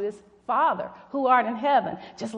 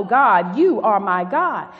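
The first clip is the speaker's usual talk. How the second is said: proclaimed louder, with force; loud scripture quote; 2 semitones lower, 8 dB louder, slower than usual. Acted. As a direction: declare it to the church. Quoting David's prayer aloud.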